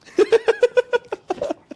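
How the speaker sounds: loud, very high giggling laugh. Real, not acted. Teasing question about little brother lands, high loud laughter.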